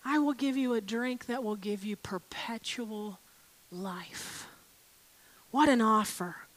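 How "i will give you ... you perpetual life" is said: hushed intimate whisper. Acted. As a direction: breathe the offer softly, almost secret. Jesus's offer whispered intimately.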